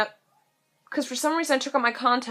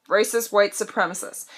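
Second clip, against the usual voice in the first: ordinary delivery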